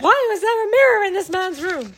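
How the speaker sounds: mock baffled. Mocking Stevenson's explanation, loud mock bafflement. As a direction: overplay the confusion.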